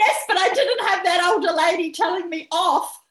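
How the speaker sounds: loud, high line through laughter; laughing retort. Retold or imitated. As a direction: laugh while explaining yourself. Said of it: "And I went".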